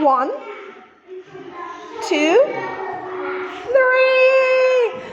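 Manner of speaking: excited count. Pretend. Counting animals with a cheer.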